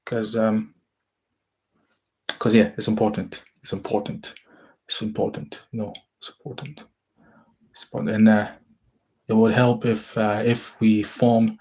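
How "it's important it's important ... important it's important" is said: soft, breathy repeats trailing to a whisper; fading to a whisper. Real, not acted. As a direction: let it die away to a whisper. Repeating for emphasis, then fading.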